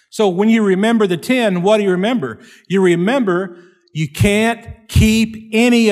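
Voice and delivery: loud, high preaching; preaching at full force. Acted. Preacher tells the congregation they need a Savior, loudly. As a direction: drive the point home loudly.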